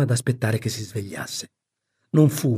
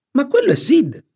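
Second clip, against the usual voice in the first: very high, louder interjection; surprised correction; 5 dB louder than usual. Acted. Aunt interrupts her sister over Sid, surprised high voice. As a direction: cut in high and astonished.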